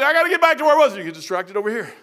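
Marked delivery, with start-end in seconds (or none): 0.0-1.0 hurried self-urging. Retold or imitated.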